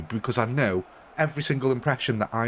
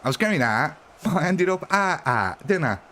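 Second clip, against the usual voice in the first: mock Cockney comedian, higher; Mickey Flanagan; 4 semitones higher than usual. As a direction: do a stand-up Cockney accent. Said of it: "The only Cockney I know is, uh, Mickey Flanagan".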